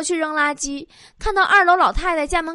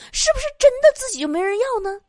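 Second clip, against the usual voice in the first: very high, fast fretting voice; mock self-doubt; 11 semitones higher, 3 dB louder, faster than usual. Woman wondering if nobody wants her, high mock-anxious voice.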